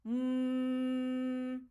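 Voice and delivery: very slow, long hum; meditative hum; sustained hum. Shown as a demonstration. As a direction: hold a steady hum. Anahat नाद.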